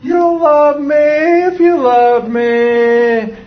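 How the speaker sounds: put-on high, loud, drawn-out kid wail; hammed-up whine held on a high note; level-two manipulative kid. Shown as a demonstration. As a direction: wail it like a child guilt-tripping a parent. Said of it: "What does level two sound like?".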